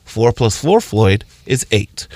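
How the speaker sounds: put-on walk the kid to the answer slowly; teacher calmly explaining to a first grader; gentle teacher. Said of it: "The teacher guided you on the path to say".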